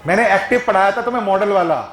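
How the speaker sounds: ordinary delivery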